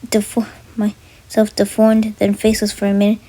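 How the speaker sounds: loud, intense storytelling; loud narration. A narrator's reading. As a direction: tell the nightmare loudly.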